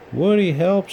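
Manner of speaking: cried for help. A narrator's reading. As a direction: call out desperately to Woody. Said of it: "she cried".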